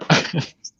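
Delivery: laughing snort through the nose; a sudden snort while laughing; snorting laughs. Real, not acted. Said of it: "Did you just snort?".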